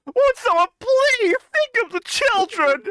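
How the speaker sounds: high, drawn-out hand-wringing voice; mock hysterical plea. Mock moral outcry.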